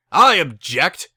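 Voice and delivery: loud furious shout from the back; yelled objection. Acted. The father bursts in to stop the wedding, shouting. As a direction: bellow it across the chapel. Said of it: "yelled a voice".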